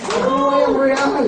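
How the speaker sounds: slow, loud cheer to the crowd; rallying cheer. Acted. Cheering the company name to the audience, slow and loud. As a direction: cheer it out to the whole room.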